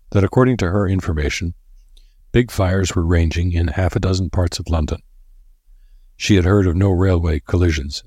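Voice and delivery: ordinary delivery, nothing marked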